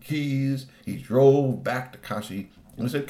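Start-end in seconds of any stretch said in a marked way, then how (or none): none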